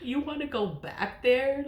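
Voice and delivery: a burst of laughing disbelief; cracking up at the thought; laughing incredulously. Real, not acted. Repeats, laughing harder.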